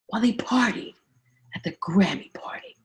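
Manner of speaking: hushed scandal whisper. Real, not acted. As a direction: drop to a conspiratorial whisper.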